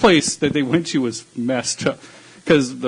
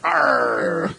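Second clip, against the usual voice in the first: pirate arr. Retold or imitated. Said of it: "Talk Like a Pirate Day".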